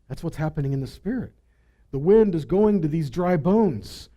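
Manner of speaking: ordinary talk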